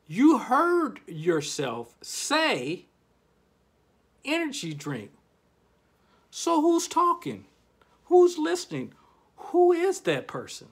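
high, loud and urgent; rising up, pressing the audience; excited questions. Acted. Host pressing listeners about who's talking, excited.

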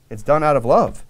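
ordinary delivery

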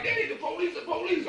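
high-pitched imitation of excited children; excited kids. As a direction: mimic kids excitedly alerting their dad. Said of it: "They excited 'cause they see police lights".

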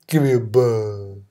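low, slow, quiet mumble; deadpan mumbled order. Retold or imitated. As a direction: drop it flat and dull. Mocking the guy's order again.

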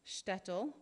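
usual speaking voice

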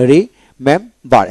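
রিমেম্বার split slowly into three syllables. Shown as a demonstration. Teacher shows learners three syllables, saying রিমেম্বার slowly in parts. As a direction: say রিমেম্বার in three separate beats. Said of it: "এখানে তিনটা syllable হলো".

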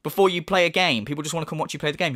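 ordinary delivery

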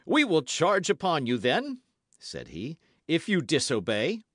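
loud, high threatening voice; exasperated, warn them hard; angry threat. A narrator's reading. Exasperated Jussac threatens to charge, angrily.

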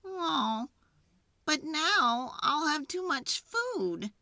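mildly sad, high character voice; high, mildly mournful animal voice; sad porcupine. A narrator's reading. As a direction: lament it as a disappointed porcupine. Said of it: "said Porcupine sadly".

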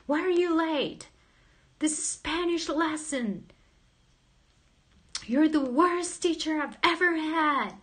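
grumpy Wayne. Retold or imitated. Streamer imitates her student scolding her for lateness, mock angry. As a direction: ham up an angry teacher's complaint. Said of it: "He's like".

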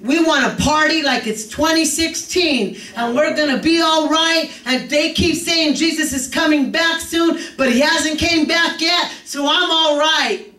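rising preacher cadence, lifted pitch; preaching. Acted. Preacher urging the congregation, fervent.

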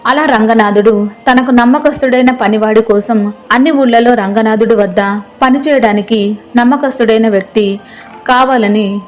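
ordinary delivery

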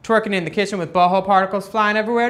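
normal talking voice

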